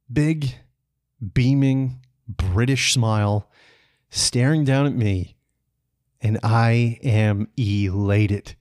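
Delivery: slow, word by word, with pauses; savoured climax. Real, not acted. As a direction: linger on each word of the happy moment. Savouring the climax of his story.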